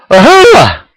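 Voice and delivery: high, loud amazed cry; gasping in amazement. A narrator's reading. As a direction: blurt the name in disbelief. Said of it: "he gasps".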